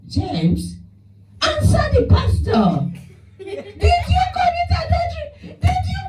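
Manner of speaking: hammed-up wife, near tears, demanding the truth; shrieking wife. Wife urging James to answer the pastor, high mock shriek. Wife voiced pressing husband.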